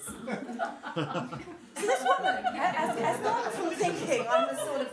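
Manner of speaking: can barely get it out for giggling; laughing while telling. Real, not acted. Laughing at her own story.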